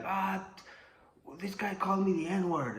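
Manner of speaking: high tattling whine; high whiny accusation. Retold or imitated. Opponent accusing him.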